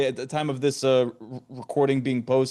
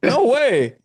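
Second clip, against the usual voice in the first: sudden high, loud no way; surprised; 9 semitones higher, 6 dB louder than usual. Real, not acted. Host hears it's the guest's dad's birthday, surprised. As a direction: burst out in real surprise.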